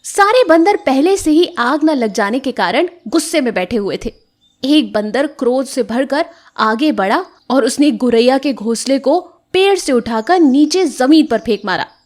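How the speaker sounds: usual speaking voice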